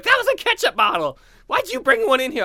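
appalled and confused; shocked woman voice. Retold or imitated. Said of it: "She's like".

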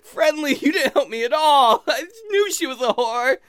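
high, whining lament; hammed-up tearful complaint; mock crying. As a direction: wail bitterly, hamming up the tears. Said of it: "when I'm crying in a corner".

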